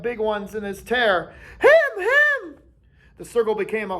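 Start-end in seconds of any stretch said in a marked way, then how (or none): 1.6-2.6 very high, loud pointing shout; frantic cry. A narrator's reading. Boys shrieking as the beast appears, very high. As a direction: shriek it out in alarm.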